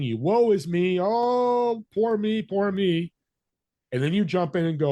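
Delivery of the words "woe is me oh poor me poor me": higher, slow, hammed-up woe is me; mock self-pity. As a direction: wallow in it theatrically, dragging it out. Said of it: "You do your humanity thing".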